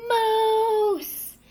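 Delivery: loud high cry of moose; father shouting on seeing the moose; yelled. A narrator's reading. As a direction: shout it in alarm. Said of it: "and yelled".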